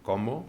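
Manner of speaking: "¿Cómo?" stressed on the final syllable, co-MO, rising; a question pushed onto the end of "¿Cómo?", co-MO; stress on the last syllable. Retold or imitated. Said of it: "acentuaba la última".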